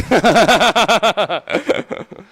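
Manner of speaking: loud, high burst of laughter; hearty amused laugh. Real, not acted. Laughs at the punchline.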